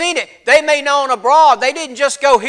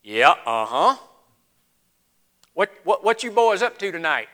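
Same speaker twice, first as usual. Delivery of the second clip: low, softer, doubtful night clerk; unimpressed shopkeeper; 7 semitones lower, 5 dB quieter than usual. Acted. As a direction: humor some excitable customers, unconvinced. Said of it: "And you're sitting there going".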